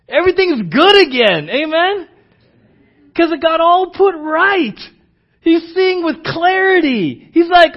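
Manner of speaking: loud, high, driving sermon voice; fervent preaching. Acted. Pastor driving home Asaph's turn to his church, loud and fervent. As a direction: preach it hard to the room.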